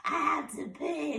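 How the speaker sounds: slow, soft, strained insistence; imitated weak patient insisting. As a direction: drag it out with little voice. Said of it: "that's how I sounded".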